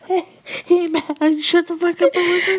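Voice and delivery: high, loud, laughing shut up; playful laughing command; laughing mock scold. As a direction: boss the audience while giggling.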